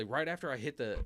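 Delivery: ordinary talk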